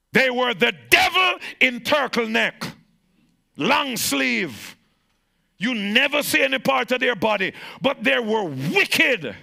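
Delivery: high, loud preacher's shout; full-force preaching. Acted. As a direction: preach it out with fire. Preaching peak.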